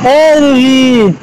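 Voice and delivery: high, drawn-out hail; stretched-out attention-getting call. Real, not acted. Calling out to child Ruhi.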